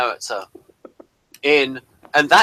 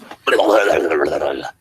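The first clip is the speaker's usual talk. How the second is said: much lower, garbled alien babble; alien fleet noise; 10 semitones lower than usual. Retold or imitated. Imitating the Andromedan fleet for his co-host, low alien babble. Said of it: "who sort of sound like this".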